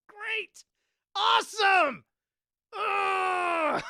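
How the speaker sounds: high, loud, sarcastic; bitter "great", then a groan; sarcastic frustration. Real, not acted. Sarcastic after losing everything.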